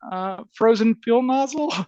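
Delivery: high, tentative questioning rise; imitated hesitant bad news. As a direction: break bad news timidly, as if asking. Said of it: "poor Tina's like".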